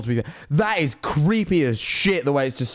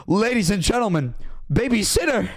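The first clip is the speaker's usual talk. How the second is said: imitated host intro, "babysitter" pitched high; Christopher Walken; 5 semitones higher than usual. Streamer jokingly introducing a babysitter, mock Walken announcement. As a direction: announce "babysitter" like a band, swooping up. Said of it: "That's how he says babysitter".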